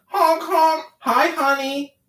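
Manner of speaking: high, loud honking clown voice; goofy clown honks and greeting; silly clown. Acted. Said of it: "My clown house wife has arrived".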